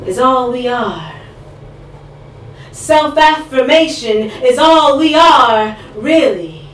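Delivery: measured, rhythmic poetry reading with dramatic pauses; spoken word poem. Acted. Poet reciting to an audience at a live reading. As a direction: perform it for the room, slow and weighty.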